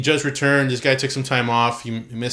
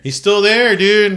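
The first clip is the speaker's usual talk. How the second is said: excited exclaim; 9 semitones higher, 8 dB louder, slower than usual. Real, not acted. Friends reacting to wrestling news.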